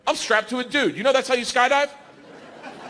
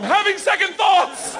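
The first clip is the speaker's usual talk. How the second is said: high, drawn-out acted wail; panicked cry; 11 semitones higher, slower than usual. Comic locks up at plane door, high panicked yell. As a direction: wail it slowly in terror.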